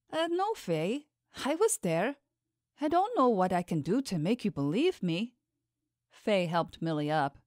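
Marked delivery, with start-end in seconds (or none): 0.1-5.3 lightly voiced servant, higher pitch; anxious servant, protesting. A narrator's reading. Servant answering Faye's doubts about the temple, lightly anxious protest. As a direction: plead innocence to a doubting mistress, a little higher.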